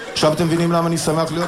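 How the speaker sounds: normal talking voice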